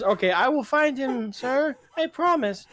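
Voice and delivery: a keen recruit eagerly promising; eager kid. Retold or imitated. Retelling the kid volunteering to the boss, high eager promise. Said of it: "the kid volunteers".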